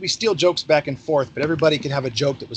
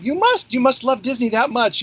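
mock excited lady; 9 semitones higher than usual. Retold lady insisting he's Mickey.

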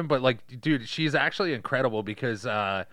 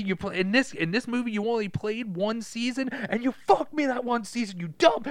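high-pitched mock outrage; acted angry rant; 9 semitones higher than usual. Ruined bettor blaming the star player, hammed-up fury. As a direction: berate the player who cost you the bet. Acted gambler ranting at Dottie.

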